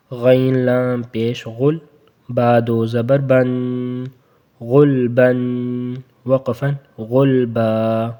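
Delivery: slow, drawn-out غلبن then غلبا; slow recitation; spelling drill. Shown as a demonstration. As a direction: sound out slowly. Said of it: "spelling drill".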